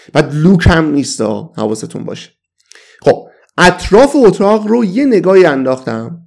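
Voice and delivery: ordinary delivery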